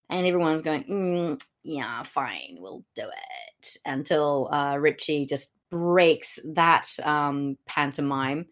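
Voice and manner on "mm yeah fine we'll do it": low, slow, reluctant mumble; grudging compliance. Retold or imitated. The family answering the king's order to embrace, grudging. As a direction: agree very grudgingly, dragging it out and dropping away. Everyone's grudging reply voiced.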